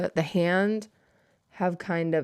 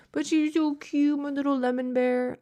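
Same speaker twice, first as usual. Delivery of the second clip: cutesy coo; 6 semitones higher than usual. Acted. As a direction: coo fondly at the bear. Gushing over faded plush.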